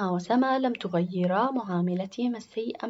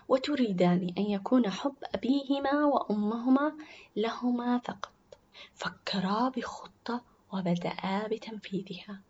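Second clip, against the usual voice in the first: ordinary delivery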